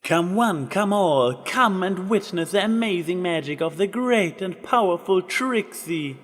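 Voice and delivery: high, grand showpony barker; showman announcement. Acted. Trixie announces her magic show to the ponies, grand and high. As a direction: sell the show to the crowd. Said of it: "magical enchanted voice echoing out".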